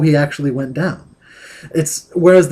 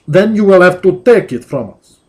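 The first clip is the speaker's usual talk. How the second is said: hammed-up Russian accent, louder; threatening thug in a bad Russian accent; mock Russian tough guy; 4 semitones higher, 7 dB louder than usual. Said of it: "Bad Russian accent".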